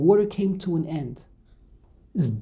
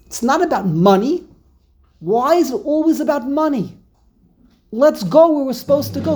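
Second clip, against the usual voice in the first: louder and higher, pressing them to move on; exasperated plea; 6 semitones higher, 5 dB louder than usual. Acted. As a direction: push the people forward, fed up with the money talk. Moshe's exasperated plea to people.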